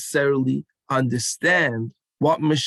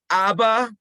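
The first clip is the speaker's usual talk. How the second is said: really loud; 7 semitones higher than usual. Shown as a demonstration. Leader calling to the kids, loud chant call. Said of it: "Really loud".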